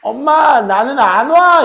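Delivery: put-on high, loud little child whining to mom; lisping little kid. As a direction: play a small kid complaining to mom. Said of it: "혀 짧은 소리 하는 애들".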